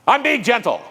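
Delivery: high, loud claim of being gentle; mock-gentle, loud. Shown as a demonstration. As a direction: belt it out, contradicting the words. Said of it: "Is gentle loud?".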